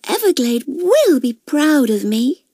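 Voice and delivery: high, slow, pleased character voice; proud young crocodile. A narrator's reading. Cassie admires her work at the mirror, high proud voice. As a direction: say it proudly, pitched well up.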